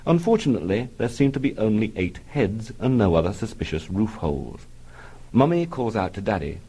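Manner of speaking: ordinary talk, nothing marked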